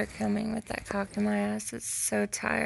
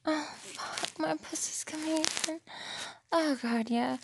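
breathless; 6 semitones higher, 3 dB quieter than usual. Real, not acted. High breathy climax.

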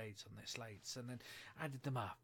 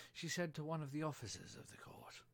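usual speaking voice

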